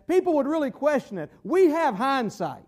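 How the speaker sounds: ordinary talk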